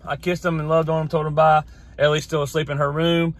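ordinary talk, nothing marked

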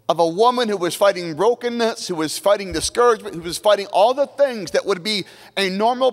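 lifted, fervent sermon build; rising preaching. Acted. Pastor preaching the mother's faith to the congregation, rising fervour. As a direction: climb into the preaching cadence.

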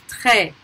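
Très ending on "eh", S silent; silent final S. Shown as a demonstration. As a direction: say Très as "treh". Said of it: "not pronounced".

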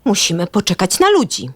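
usual speaking voice

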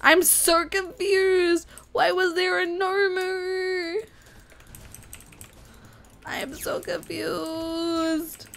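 high, drawn-out bewildered whine; confused whine. Real, not acted. Player baffled by the Nomu, drawn-out whine. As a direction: wail it slowly, stretching the last word. Bewildered gamer wailing at surprise enemy.

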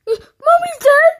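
very high, loud shriek of disbelief; gasp, then shriek it as a question; hammed-up horrified cry; shocked scream. Screamed repeat of the news.

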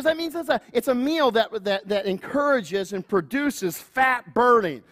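lightly voiced wife, patient; wife explaining. Wife explaining keto to husband, lightly voiced. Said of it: "She goes".

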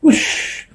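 high, voiceless whoosh through pursed lips; whispered whoosh. A narrator's reading. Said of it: "pursed his monkey lips and said".

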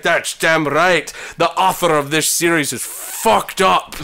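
slow mock Scottish brogue; Scottish accent. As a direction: pronounce it as a slurring Scottish spy. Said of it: "overcome by his Scottish accent".

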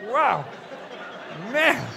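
high shocked yelp; shocked cry. Real, not acted. Hearing the snake bit him, a high shocked cry. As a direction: blurt it out in shock.

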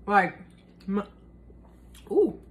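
coughing mid-sentence; sputtering after swallowing wrong; choking on drink. Real, not acted. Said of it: "almost choked".

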